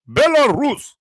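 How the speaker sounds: "Belarus" said high and stretched in a mock Russian accent; playful Russian accent on "Belarus". Shown as a demonstration. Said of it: "Do I sound Russian?".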